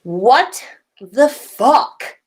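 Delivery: drawn-out startled yell; mock yell. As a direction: shout it slowly in shock. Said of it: "Harry yelled".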